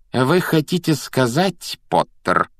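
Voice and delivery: slow, high, indignant question; restrained outrage; barely controlled anger. A narrator's reading. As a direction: hold the anger in, stretch the words. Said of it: "она уже вне себя и лишь усилием воли держит себя в руках".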